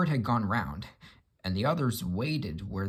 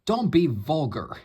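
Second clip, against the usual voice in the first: a louder, higher huffy retort; huffily; 5 semitones higher, 6 dB louder than usual. Acted. Gordon rebuffs Henry's washout advice, huffy. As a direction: sniff at them in offended dignity. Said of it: "said Gordon huffily".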